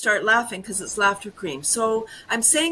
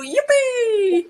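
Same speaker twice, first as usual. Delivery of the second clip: high loud yippee then laughter; yippee cheer; 12 semitones higher, 6 dB louder than usual. Shown as a demonstration. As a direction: shout it for joy, arms up. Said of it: "just go".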